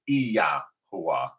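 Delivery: Yahuwah with the Y drawn out as "ee-yah", then hoo-ah; proper pronunciation. Shown as a demonstration. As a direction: stress the opening ee of Yahuwah. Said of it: "that Y is actually".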